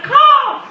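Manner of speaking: loud, high eagle screech; eagle caw. Retold or imitated. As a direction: screech like an eagle, full volume. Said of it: "make noises like an eagle".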